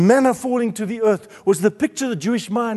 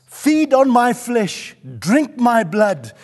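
slow, raised preacher's cry; forceful proclamation; 5 semitones higher, 4 dB louder, slower than usual. Acted. Preacher proclaiming Jesus's command to the congregation, forceful proclamation. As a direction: proclaim each phrase at full force.

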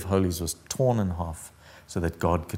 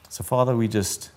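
ordinary talk, nothing marked

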